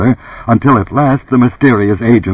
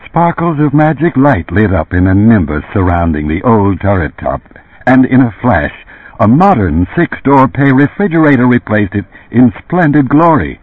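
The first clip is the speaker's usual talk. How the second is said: plain reading voice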